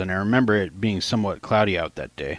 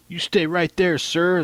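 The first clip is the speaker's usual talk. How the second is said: snarling cop; 9 semitones higher, slower than usual. Retold or imitated. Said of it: "the cop snarled".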